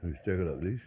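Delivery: ordinary delivery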